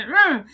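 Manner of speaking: loud mock "mm" swooping in pitch; wife's grumbling noise, imitated; imitated grunt. Wife grunting back at her husband, imitated loud grunt. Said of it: "y Seyran hace".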